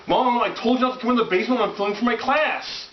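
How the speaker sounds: put-on high, fast annoyed teen yell; yelling across the room. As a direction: whine back at mom, fast and high. Son complains at mom across the room.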